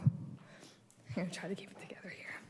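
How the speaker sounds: near-whisper, fighting to stay composed; holding back tears. Real, not acted. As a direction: breathe the words out, barely holding it together. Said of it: "I might get emotional".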